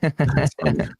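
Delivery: high laughing voice. Real, not acted. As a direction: laugh the words out. Laughing repeat.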